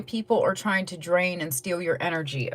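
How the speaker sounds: ordinary delivery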